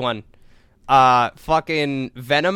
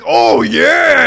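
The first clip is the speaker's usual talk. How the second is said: loud, high imitated whoop; mock gleeful shout; 7 semitones higher, 10 dB louder than usual. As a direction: shout it like a monster let loose. Acting out Venom's delighted agreement.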